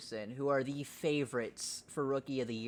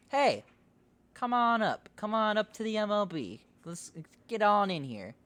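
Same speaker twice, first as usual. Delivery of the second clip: high, lightly put-on welcoming voice; a hint of a cheerful team inviting the rookies up; lightly voiced cheery team; 8 semitones higher than usual. Host voices the MLB teams welcoming the prospects, lightly cheerful.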